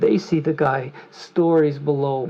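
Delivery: ordinary talk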